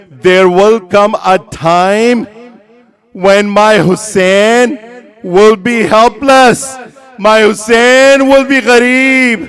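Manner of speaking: loud, mournful oration; impassioned lament. Acted. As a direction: read the father's letter as a swelling, grieving plea. Reads dead father's letter as lament.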